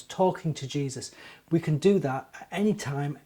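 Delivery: usual speaking voice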